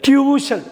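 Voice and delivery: "டியூஷன்" as "tyoo-shun", high first syllable; correct pronunciation. Shown as a demonstration. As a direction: pitch up "டியூஷன்" with a y-glide after t. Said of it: "டியூஷன் கிடையாது".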